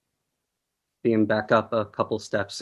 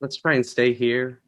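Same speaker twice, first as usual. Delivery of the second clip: ordinary delivery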